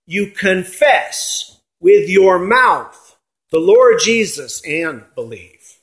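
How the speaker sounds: "confess" weighted, the whole phrase read slowly; emphasis on confess. Shown as a demonstration. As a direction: lean on "you confess with your mouth", unhurried. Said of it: "I put the emphasis there on the confession part".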